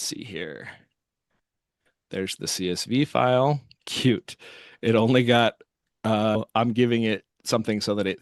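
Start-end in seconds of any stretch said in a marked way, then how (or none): none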